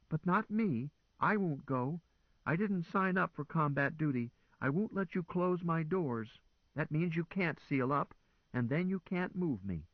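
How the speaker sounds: high, defiant machine voice; stubborn car. A narrator's reading. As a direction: dig in and refuse like a scared car. Car refuses combat duty.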